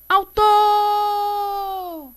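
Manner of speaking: high call with "tor" held very long. Shown as a demonstration. Teacher calls the word to find stress, long high "autooor". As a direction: call "autor" like hailing someone far away. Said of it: "Se eu chamo autor".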